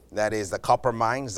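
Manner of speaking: ordinary talk, nothing marked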